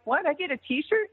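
high questioning retold surprise; surprised. Wrestler answering Mark's T-shirt text, surprised. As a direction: ask it in pleased disbelief.

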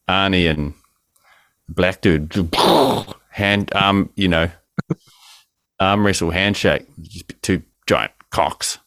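usual speaking voice

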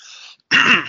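loud throat-clearing grunt. Real, not acted. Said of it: "Here I am coughing and grunting".

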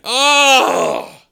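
high anguished cry of protest; anguished wail. Acted. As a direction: wail it out, wounded and put-upon. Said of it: "instead of just saying".

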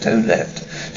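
a stunned repeat under the breath; hushed whisper. Acted. Repeats count, shocked and hushed.

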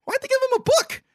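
high, puzzled questioning. Retold or imitated. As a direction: act confused at the present. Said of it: "I still was like".

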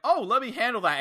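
high, light mock referee. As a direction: toss it off like a sneaky ref. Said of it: "So he went like".

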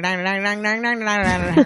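mock twangy Southern gibberish; thick Southern accent. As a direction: rattle out an exaggerated Southern twang. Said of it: "before it was just like".

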